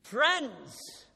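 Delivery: loud, high call of Friends to hush a crowd; loud preacher's call over a chatting crowd; booming call to the crowd. Retold or imitated. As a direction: bellow it out to grab seven hundred people's attention. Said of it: "he simply declared like this".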